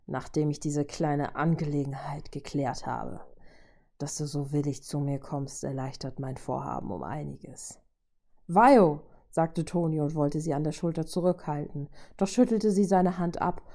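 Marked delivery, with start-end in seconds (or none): 0.1-7.8 lightly voiced villain, dropping softer and lower; smug, measured and quiet; robot villain, pleased. A narrator's reading. Robot welcoming the willing woman, quietly pleased menace. Narrator voices Ultron's speech. 8.5-9.0 sharp, louder warning call. A narrator's reading. Teammate trying to hold her back, urgent call.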